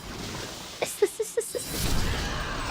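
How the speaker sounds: soft, high, breath-only giggle; wheezy laugh. Real, not acted. Streamer laughing at own princess joke, soft wheeze. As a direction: laugh quietly in hissing bursts.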